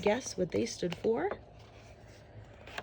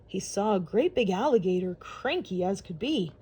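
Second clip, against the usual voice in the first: ordinary delivery, nothing marked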